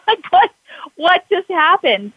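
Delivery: high, slow, laughing wonder; laughing disbelief. Real, not acted. Telling the host her amazement, laughing. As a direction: laugh it off, amazed at what happened.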